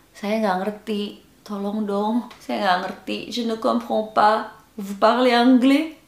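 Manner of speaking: pitiful plea for help; put-on helpless pleading. Shown as a demonstration. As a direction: play a helpless, lost foreigner begging. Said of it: "Dengan muka memelas lagi".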